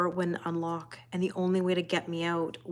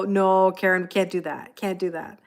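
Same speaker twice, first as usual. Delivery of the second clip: usual speaking voice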